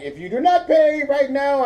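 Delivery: high, loud put-on caller voice with an accent; imitated angry woman's voice. Mimicking woman scammer demanding payment, imitated angry voice. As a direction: press for payment in a mock-irate woman's voice. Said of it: "supposed to be not doing the, the voice".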